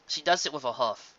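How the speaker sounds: plain reading voice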